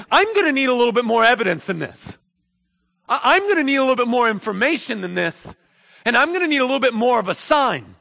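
high, loud, insistent doubt; skeptical demand. Acted. Zechariah demands a sign from the angel, skeptical demand. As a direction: push back loudly for proof.

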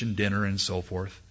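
normal talking voice

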